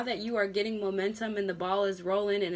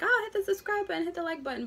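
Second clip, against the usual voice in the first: high, fast mock plug for subscribers; pushy youtuber; 10 semitones higher, faster than usual. A creator begging viewers to subscribe, high and fast. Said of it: "I try not to be like".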